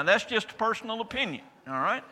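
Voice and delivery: ordinary talk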